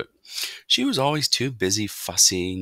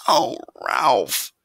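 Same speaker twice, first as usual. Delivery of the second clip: higher, louder wail of a mother; dismayed cry; 6 semitones higher, 5 dB louder than usual. A narrator's reading. Mother hears son wants the ground floor, dismayed cry. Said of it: "cried his mother".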